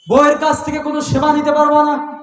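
high, forceful chanted commands; high, sustained sermon proclamation; impassioned preached decree. Acted. Voices the boycott decree.

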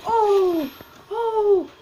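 real excited, high-pitched gasped ohs; high "oh" of real excitement, repeated. Real, not acted. Streamer reacting to a loot box reveal, excited. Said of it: "I don't know why I'm so excited".

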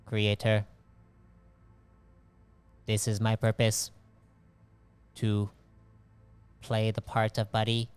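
level, monotone artificial mind; measured, halting machine accepting its purpose; compliant AI. Acted. Game master as an AI accepting the Buddy role, measured and monotone.

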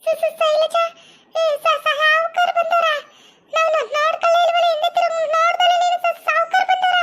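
high-pitched, stuttering alarm; startled stammering. Acted. Two women realise the landlord has arrived, startled stammering. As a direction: trip over the first syllables in startled surprise.

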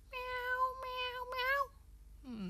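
high, drawn-out mews; high kitty meows; cat meowing. Pretend.

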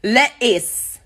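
"Lettuce" as le'iss with a glottal stop; no T's. Shown as a demonstration. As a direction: drop the middle T in "Lettuce". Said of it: "No T's".

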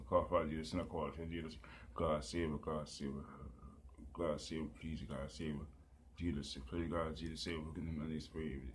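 soft, repetitive murmured prayer; pleading prayer. Real, not acted. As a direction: plead with God under your breath.

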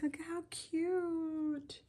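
high, soft, drawn-out coo; cooing adoration. Real, not acted. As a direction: gush slowly over something adorable. Gushing over her finished cute pieces.

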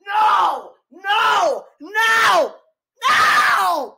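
distraught shouting. Real, not acted. Viewer refusing to accept the episode's ending, distraught shouting. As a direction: shout it in real disbelief.

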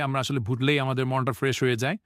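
ordinary talk, nothing marked

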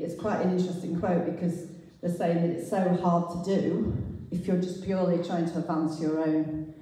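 normal talking voice

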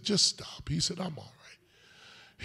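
hushed, almost whispered; quiet. A narrator's reading. Colleague reassuring Packer, hushed and calm. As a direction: soften to a calm near-whisper.

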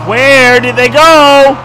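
high, slow, stretched-out loud call; a mock dramatic wail of the question; drawn-out yell. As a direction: holler it long and high across the map. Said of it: "drawn-out repeat of the question".

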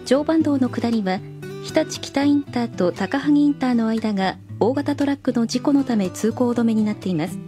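ordinary talk, nothing marked

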